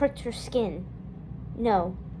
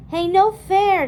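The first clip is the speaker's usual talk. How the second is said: loud, high call; 7 semitones higher, 7 dB louder than usual. A narrator's reading. Dawn calling to Felipa in the maze, loud protest. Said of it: "Dawn called out".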